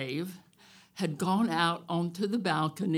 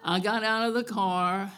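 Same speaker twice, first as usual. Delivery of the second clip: ordinary delivery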